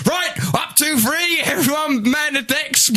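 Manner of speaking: high, slow shouted sea captain; captain's call. Acted. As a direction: bellow orders to the crew, high. Ship captain shouting at crew.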